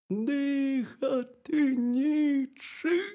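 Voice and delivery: high, drawn-out hare from underground; hollow, high wailing hare; muffled burrow voice. A narrator's reading. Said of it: "сказав заєць".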